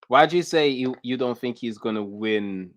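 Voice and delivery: ordinary talk, nothing marked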